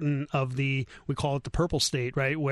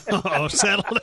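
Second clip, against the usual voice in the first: real laughter breaking into the words; laughing; 5 semitones higher, 4 dB louder, slower than usual. Real, not acted. Host reacting to co-host's voting joke, laughing. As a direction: crack up while talking.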